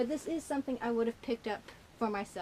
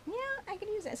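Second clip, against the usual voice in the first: high fake-cheerful voice; 12 semitones higher, faster than usual. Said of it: "So it's like".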